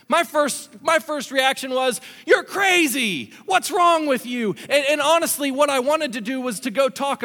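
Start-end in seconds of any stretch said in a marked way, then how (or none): 2.3-4.5 loud, high, stretched-out indignation; heated, raised-voice confrontation; angry outburst. Retold or imitated. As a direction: lash out at someone in disbelief. Acts out his angry first reaction.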